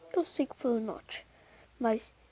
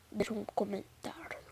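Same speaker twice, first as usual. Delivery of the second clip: whisper; 5 semitones lower, 11 dB quieter, faster than usual. Real, not acted. Aside after asking for likes.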